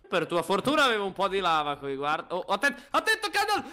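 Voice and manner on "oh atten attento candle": high-pitched, urgent cry; panicky, broken-off call; alarmed warning. Real, not acted. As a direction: warn your friend in sudden alarm. Urgent warning to co-player in game.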